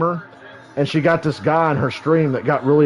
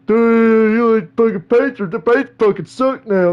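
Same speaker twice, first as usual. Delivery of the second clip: hammed-up louder, higher jeering troll; imitating a jeering troll, louder and higher; mock heckler; 6 semitones higher, 4 dB louder than usual. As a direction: sneer like a chat troll. Retold troll mocking the Pacers.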